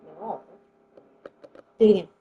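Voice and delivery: ordinary talk, nothing marked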